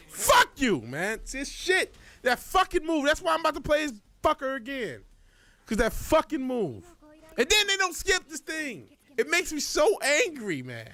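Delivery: high, heated complaint about the move; real irritation at the opponent's sweep; angry rant. Real, not acted. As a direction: vent the frustration upward. Ranting about the unpunishable move.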